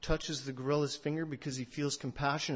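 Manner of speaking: usual speaking voice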